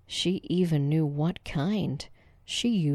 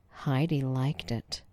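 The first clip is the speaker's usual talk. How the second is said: usual speaking voice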